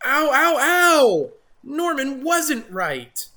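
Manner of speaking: high, loud yelps; pained ow. Acted. Jim with a bellyache, yelping. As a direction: yelp from a stomach ache.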